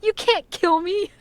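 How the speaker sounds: playfully outraged husband; mock husband protest. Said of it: "He's like".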